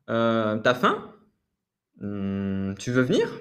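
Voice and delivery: t'as faim? drawn out slowly, faim rising; slow exaggerated rise. Shown as a demonstration. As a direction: stretch t'as faim? and climb up on faim. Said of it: "vraiment monter".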